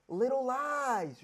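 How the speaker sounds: very slow, each word stretched; drawn out. Shown as a demonstration. Comic dragging the key phrase out very slowly. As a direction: drag out little lies. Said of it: "stretched for emphasis".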